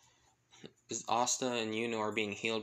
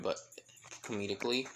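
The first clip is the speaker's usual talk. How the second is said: ordinary delivery, nothing marked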